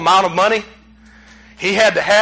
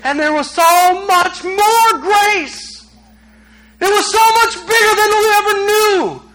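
high, drawn-out preacher's cry; soaring climactic exclamation; impassioned preaching; 10 semitones higher, slower than usual. Real, not acted. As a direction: proclaim it at full pitch.

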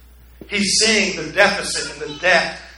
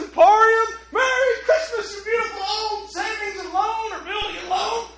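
much higher, slow, shouted with laughing tears; joyful shout; 5 dB louder than usual. Retold or imitated. George running through Bedford Falls, laughing and crying joyful shout. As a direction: yell it to the whole town in pure joy. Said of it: "he says".

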